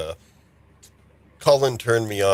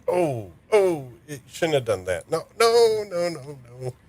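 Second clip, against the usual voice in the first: dismayed; 7 semitones higher than usual. Retold or imitated. Dismayed reaction quoted while watching.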